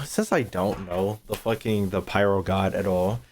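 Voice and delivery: ordinary talk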